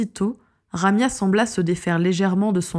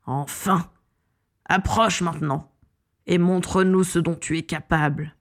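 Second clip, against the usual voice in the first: lightly voiced villain savouring triumph; understated roar of triumph; triumphant villain. A narrator's reading. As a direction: gloat with restrained menace, no real roar. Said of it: "un rugissement de triomphe".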